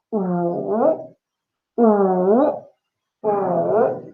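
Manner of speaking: wu with small lips, dipping down and up; demonstrated wu. Shown as a demonstration. Teacher showing the dipping tone of wu to the class. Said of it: "it goes down and up".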